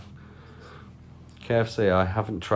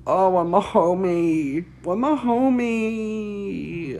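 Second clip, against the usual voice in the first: much higher, slow sing-song call; 9 semitones higher, much slower than usual. Acted. Streamer calling for a missing viewer in a high slow voice. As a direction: drawl it out searching for a friend.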